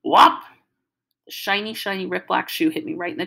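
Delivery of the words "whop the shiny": plain reading voice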